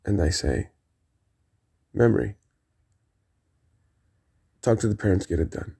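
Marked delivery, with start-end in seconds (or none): 1.9-5.8 understated, retold gangster menace; gangster boss. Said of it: "I looked over to Memory like a gangster".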